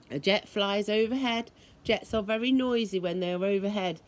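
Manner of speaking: ordinary delivery, nothing marked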